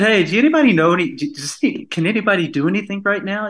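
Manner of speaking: a producer frantically asks if anyone can run sound, fast and high-pitched; urgent excited plea. Retold or imitated. Producer pleading for a sound engineer.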